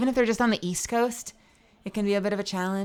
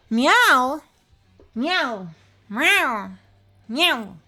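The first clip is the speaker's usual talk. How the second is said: playful imitated cat meows, first with a gasp; 4 semitones higher, 4 dB louder, much slower than usual. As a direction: meow back at the viewer like a cat. Meowing back at viewer.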